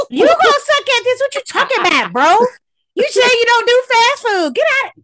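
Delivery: loud, high-pitched outburst; heckling shout. Real, not acted. Heckling a player who avoids fast food, high heckling shout. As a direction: yell it in exaggerated disbelief.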